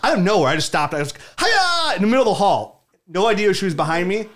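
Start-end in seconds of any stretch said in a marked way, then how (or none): 1.4-2.0 high-pitched mock karate yell; karate cry. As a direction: burst out with a silly high "hi-ya". Re-enacts his sudden karate chop.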